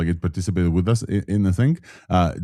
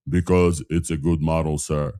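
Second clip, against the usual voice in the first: low-pitched voice; 5 semitones lower than usual. Shown as a demonstration. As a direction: drop your voice low. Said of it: "in a low voice".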